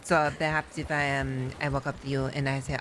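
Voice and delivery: ordinary talk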